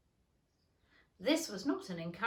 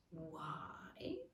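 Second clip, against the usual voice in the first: whisper; 4 semitones lower, 11 dB quieter than usual. Acted. Caterpillar's terse question.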